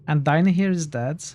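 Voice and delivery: ordinary delivery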